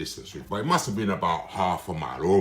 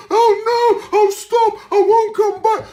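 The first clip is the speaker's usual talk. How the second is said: a shrill, very high mock pleading voice, much louder; high squeaky imitation; 10 dB louder, slower than usual. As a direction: squeal it like a frightened woman begging. Voicing the doctor begging in his head.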